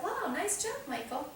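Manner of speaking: high, bright praise. Retold or imitated. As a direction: lift it up with delight. Counselor praises the boy.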